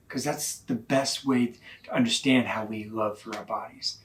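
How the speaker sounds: ordinary delivery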